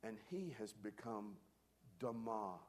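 ordinary talk

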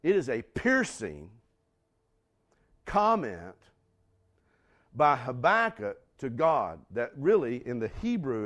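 normal talking voice

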